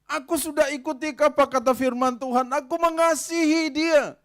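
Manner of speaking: high, loud wounded protest, slowing at the end; aggrieved loud lament. Acted. Preacher voicing a bitter wife to the church, loud and hurt. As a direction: cry out how much you gave.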